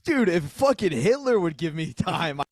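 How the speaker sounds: loud, high incredulous rant; hammed-up scandalized disbelief; mock outrage. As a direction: protest as a shameless gig-hungry comic. Hammed-up outrage at comedian with ethics.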